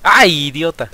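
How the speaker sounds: loud high exasperated cry. Real, not acted. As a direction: yell at the game in frustration. Reacts to a bad hit.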